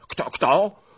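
high, loud startled question; changed voice, suddenly alarmed. A narrator's reading. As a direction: blurt it in sudden fright. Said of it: "spytał nagle z gola innym głosem".

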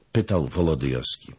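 usual speaking voice

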